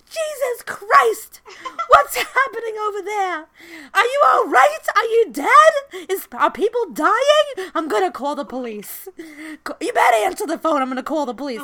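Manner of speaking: put-on a frantic New York grandma, Queens accent, half laughing; grandmother's New York accent. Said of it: "My grandmother's New York accent".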